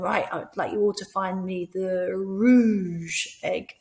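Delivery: slow, clear rouge egg; slow instruction. Shown as a demonstration. Teacher setting a colour egg hunt. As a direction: spell the task out slowly. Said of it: "you might say to them".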